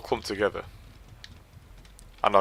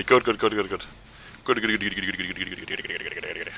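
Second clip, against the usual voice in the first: fading mutter; 4 semitones lower, 9 dB quieter than usual. Real, not acted.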